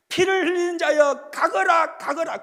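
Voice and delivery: loud mocking yell of a man cursing the fleeing king; Shimei's taunting shout. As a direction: shout it out, jeering. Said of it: "그러는 겁니다". (조롱하며 외치는 고함)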